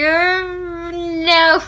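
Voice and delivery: high, whiny half-cry no; mock crying. Fan retelling her reaction to the epilogue, mock crying. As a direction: whimper no like near tears. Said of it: "I was just like".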